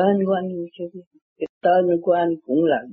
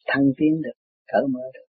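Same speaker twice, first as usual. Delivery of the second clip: ordinary talk, nothing marked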